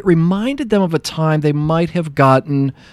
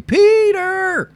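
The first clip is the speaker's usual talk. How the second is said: high drawn-out call across the store; calling name out. Retold or imitated. Wife calling across the store, high drawn-out call. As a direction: call his name from afar, stretched out. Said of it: "And finally".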